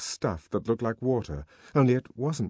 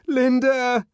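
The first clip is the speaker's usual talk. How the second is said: high, loud cry for mother; desperate child's shout; 9 dB louder than usual. A narrator's reading. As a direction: yell through a shut door. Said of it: "he shouted".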